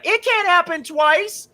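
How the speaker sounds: high, slow, smug certainty; cocky inner voice. Retold or imitated. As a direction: stretch it out high like it's obvious. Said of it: "my thought was like".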